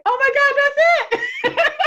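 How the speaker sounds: high, loud laughing exclaim; excited realization. Retold or imitated. Retelling the coach's words hitting home, laughing excited shout. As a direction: burst out laughing as it clicks. Retold moment of sudden realization.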